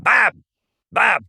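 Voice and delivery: high, curt call as if to a small dog; dismissive, belittling name-call; talking to a chihuahua. Retold or imitated. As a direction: imitate scolding a lapdog. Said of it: "talking to him like he's a chihuahua".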